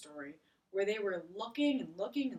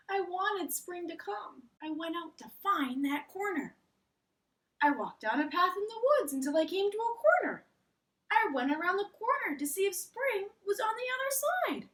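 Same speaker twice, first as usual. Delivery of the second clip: higher and louder, eager Frog on a quest; Frog's voice; 10 semitones higher, 7 dB louder than usual. Acted. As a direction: Frog telling Toad about hunting spring, lively and high. Frog tells his search.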